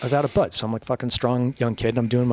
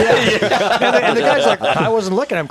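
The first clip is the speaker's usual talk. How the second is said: laughing; 10 semitones higher, 7 dB louder than usual. Real, not acted. Laughing while retelling.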